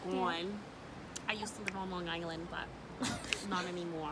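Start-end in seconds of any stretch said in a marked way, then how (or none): none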